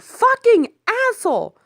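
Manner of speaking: very high, loud cursing scream; screaming curses after the driver; furious yell. Acted. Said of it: "and yelled".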